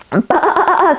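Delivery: high bleating baa; a sheep's high bleat. Acted. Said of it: "said Sheep".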